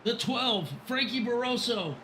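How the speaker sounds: high, urgent race call; live play-by-play. Real, not acted. Announcer to viewers as pace car leaves, live play-by-play. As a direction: call the restart with rising anticipation.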